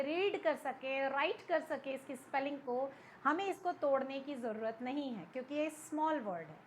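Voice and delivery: usual speaking voice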